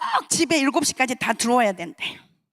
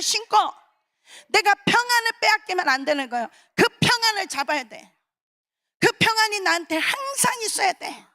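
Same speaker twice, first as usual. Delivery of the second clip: raised, impassioned sermon voice; preaching; 7 semitones higher than usual. Acted. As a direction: preach it high and fervent. Preaching at full force.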